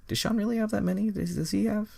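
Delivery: squeaky high disbelief, quick; incredulous. Real, not acted. Reader stopping to question the description of Sean, high-pitched disbelief. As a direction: shoot the voice way up in disbelief. Incredulous aside about a friend.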